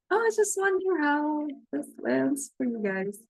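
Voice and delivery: ordinary talk, nothing marked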